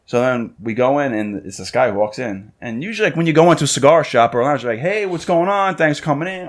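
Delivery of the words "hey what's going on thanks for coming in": higher-pitched, cheery shopkeeper greeting; friendly, lilting hello. Acted. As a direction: greet a customer like a welcoming shopkeeper. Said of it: "Like, friendly".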